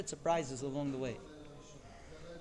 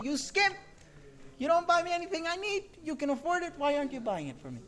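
high-pitched mock yell, easing off at the end; imitated yelling wife; 10 semitones higher than usual. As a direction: act out a wife scolding her husband in public. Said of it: "she started yelling at me".

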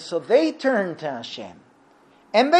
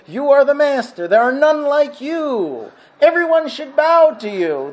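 mock worshipful praise; 6 semitones higher, 5 dB louder than usual. Congregants praising God while walking out of shul, mock worshipful. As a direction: declaim praise grandly, pitched up. Worshippers praying as they walk out.

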